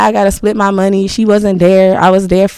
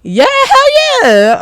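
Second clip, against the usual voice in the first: delighted yes; much slower than usual. Real, not acted. Eager yes to fifteen a month.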